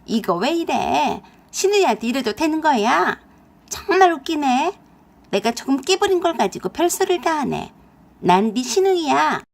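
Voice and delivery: a brazen woman laughing off the accusation, loud and high; mocking other woman. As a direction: scoff and sneer at her rival. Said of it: "그 여자가 ... 눈가를 뒤집더니 그랬어요".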